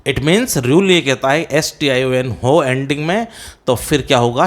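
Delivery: ordinary talk, nothing marked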